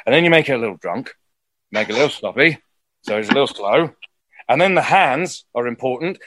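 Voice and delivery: put-on slightly drunk, sloppy English pirate; Jack Sparrow. Shown as a demonstration. Teaching the layered impression, drunk sloppy Jack Sparrow. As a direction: slur it like a tipsy Englishman wary of germs. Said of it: "like Jack Sparrow".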